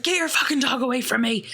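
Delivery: a hammed-up angry growl at the dog; mock growl. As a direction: snap it out like a mildly freaked-out man. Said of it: "he, like, growls it".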